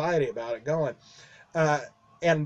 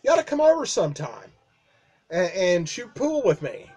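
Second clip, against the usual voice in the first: quick mock boss invite; 3 semitones higher than usual. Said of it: "he goes".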